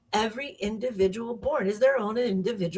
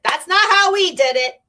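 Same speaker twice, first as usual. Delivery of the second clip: put-on raised, louder old-school mom objection; mock disapproving mom; 8 semitones higher, 6 dB louder than usual. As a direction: push back like a mother set in her ways. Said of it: "maybe the mom is like".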